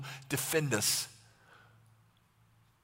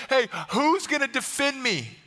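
higher, urgent appeal; acted desperation, voice raised; desperate plea; 4 semitones higher than usual. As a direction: plead for someone to help. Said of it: "in this place of desperation".

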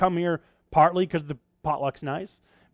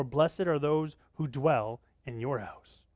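slow solemn reading; much slower than usual. Acted.

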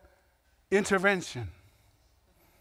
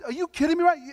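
outraged disbelief; 8 semitones higher, 3 dB louder, faster than usual. Retold or imitated. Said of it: "He's like".

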